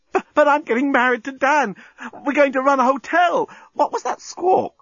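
very high, flustered squeal; distraught woman. A narrator's reading. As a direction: stammer and wail in high-pitched panic. Lucy panics over her engagement.

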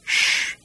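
breathless hushing shh; whispered shush. A narrator's reading. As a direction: hush them without voice. Slartibartfast hushing Arthur.

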